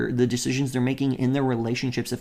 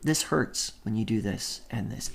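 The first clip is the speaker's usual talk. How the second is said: softly voiced hurt; gentle; 3 semitones lower, 8 dB quieter than usual. Shown as a demonstration. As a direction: say it gently, no blame. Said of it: "No, it's".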